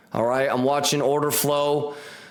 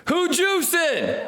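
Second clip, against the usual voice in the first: high, slow shouted question; hype shout; 12 semitones higher, much slower than usual. Real, not acted. Streamer shouting to his viewers, high slow hype call. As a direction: call out to the whole chat.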